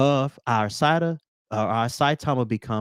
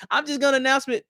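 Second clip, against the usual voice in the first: usual speaking voice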